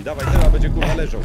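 loud excited yell; battle roar. Real, not acted. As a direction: shout it out mid-fight. Boss fight, excited call again.

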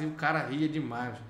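normal talking voice

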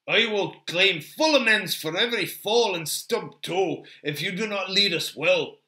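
put-upon, emphatic complaint; grumbling Gimli. Acted. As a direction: warn your guide with gruff bluster.

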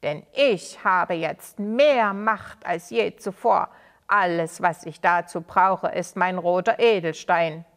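sneering villain voice, loud; old villain taunting the angry townspeople; malicious grin. Acted. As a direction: gloat with an evil grin. Villain boasts of his power.

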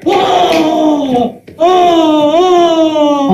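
hammed-up ogre roar in a game; play monster. Dad playing ogre for his kids, mock roaring.

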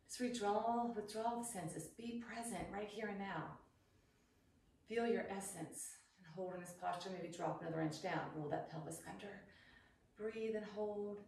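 soft, hushed meditation voice, drawn inward; guided meditation. Acted. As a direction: guide them into stillness softly. Leads a meditation in chair pose.

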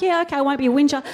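put-on fast, sulky little-kid mumble. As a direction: rattle it off like a told-off child. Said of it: "I'm like".

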